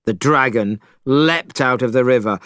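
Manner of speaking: usual speaking voice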